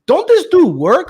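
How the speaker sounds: can't believe this guy never works; incredulous. Retold or imitated. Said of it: "I'm like".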